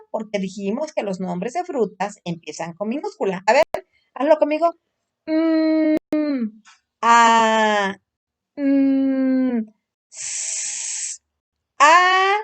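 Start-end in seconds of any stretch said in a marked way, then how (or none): 5.3-12.3 drawn-out mm, open held a, long hissed s; slow phonics demonstration; sounding out letters. Shown as a demonstration. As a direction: stretch each letter sound separately. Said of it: "pronuncia conmigo".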